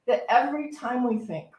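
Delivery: usual speaking voice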